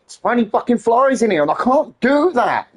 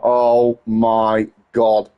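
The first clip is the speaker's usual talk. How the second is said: lower, slow drawn-out disbelief; exasperated groan; 12 semitones lower, much slower than usual. Retold or imitated. To himself about the swarming flies, slow low exasperation. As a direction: let it sag out, utterly fed up. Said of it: "I'm like".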